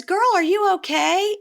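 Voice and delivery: high sweet Tanya. Retold or imitated. Tanya to the crying girl, high sugary concern. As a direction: coo it with fake concern. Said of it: "So Tanya goes".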